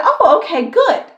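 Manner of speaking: high, loud mocking "oh, okay, good"; mock boss voice. Mocking the boss's fake cheer, said loud and high.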